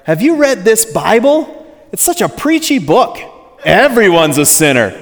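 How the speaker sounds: a preacher mimicking Homer's grumble about the Bible; Homer Simpson. Preacher lightly voicing Homer griping to Bart. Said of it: "And he says to Bart".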